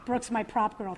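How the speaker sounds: normal talking voice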